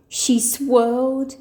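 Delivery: ordinary delivery, nothing marked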